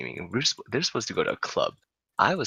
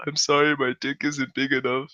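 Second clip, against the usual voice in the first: high-pitched mock crying voice; imitated tearful apology; 5 semitones higher than usual. Crying drunk apologizes to girl in car, mock tearful whine. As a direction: plead like a weepy drunk. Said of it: "he starts crying".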